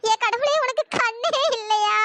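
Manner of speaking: slow, sobbing plea to God; a mother's slow wailing cry; tearful lament. Acted. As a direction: cry out to heaven in grief. Mother laments daughter's ruined marriage.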